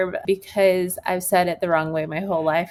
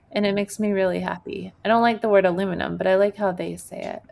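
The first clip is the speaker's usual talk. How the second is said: ordinary talk, nothing marked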